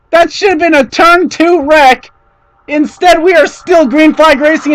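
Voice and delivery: excited. Real, not acted. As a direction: still buzzing after the save. Explaining after near wreck.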